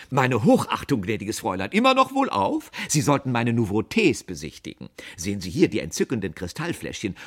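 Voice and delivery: loud, lively, fawning greeting; effusive shop boss. A narrator's reading. Boss greeting a known customer, loudly effusive. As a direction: gush over the valued customer.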